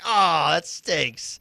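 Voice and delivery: high, loud, whiny "aw"; pouty put-on letdown; mock disappointment. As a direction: whine it like a let-down kid.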